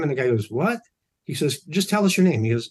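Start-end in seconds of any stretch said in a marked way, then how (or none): 0.5-0.8 high, puzzled what; high startled reply. Retold or imitated. Stranger startled by a tap. 1.6-2.4 higher, louder insisting; mild mock insistence; insistent demand. Insisting stranger says his name.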